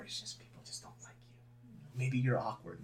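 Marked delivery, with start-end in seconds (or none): 0.0-1.8 hushed aside. Acted. Drops voice for blunt truth.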